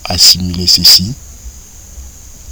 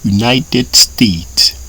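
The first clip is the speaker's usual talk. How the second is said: very slow, syllable by syllable; drawn-out teacher pronunciation; slow model reading; much slower than usual. Shown as a demonstration. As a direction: spell it out slowly for learners. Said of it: "pour dire États-Unis".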